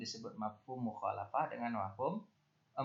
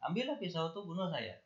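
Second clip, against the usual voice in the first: pitched-up mock outburst of a cross parent; angry parent; 7 semitones higher than usual. Teacher quoting an angry parent to pupils, mock anger. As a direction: snap it like a parent at the end of their rope. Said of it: "orang tua marah terus bilang".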